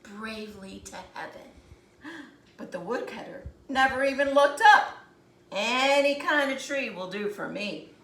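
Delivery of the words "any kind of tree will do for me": usual speaking voice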